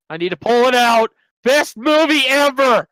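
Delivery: high, loud gushing; excited. Real, not acted. Streamer gushing over The Greatest Showman, excited. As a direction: gush about your favourite movie. Excited plugging favourite movie.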